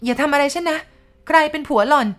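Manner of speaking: terrified. Acted. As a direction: scream in terror at the ghost. Wife facing ghost. (ร้องเสียงสูงดังด้วยความหวาดกลัว)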